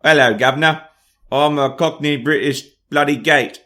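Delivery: loud, hammed-up Cockney gate; forced Cockney. Shown as a demonstration. Showing how a forced accent goes wrong, hammed-up Cockney. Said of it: "You force the accent".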